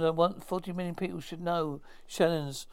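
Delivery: ordinary talk, nothing marked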